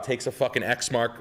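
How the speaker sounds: ordinary delivery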